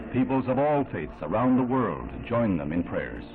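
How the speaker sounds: ordinary talk, nothing marked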